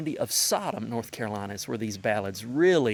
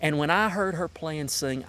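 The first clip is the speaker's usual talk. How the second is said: normal talking voice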